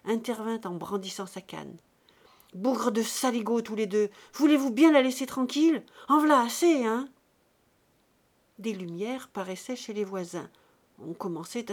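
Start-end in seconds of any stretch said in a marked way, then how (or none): 2.5-7.1 put-on much louder and higher, an old man's fury; outraged shout. A narrator's reading. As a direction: roar at them with the cane raised. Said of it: "révolté". (colère indignée du vieux)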